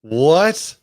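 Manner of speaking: loud, high rising what; incredulous, pitch shooting up; real surprise. Real, not acted. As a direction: react in open disbelief. Reacts to surprising comment.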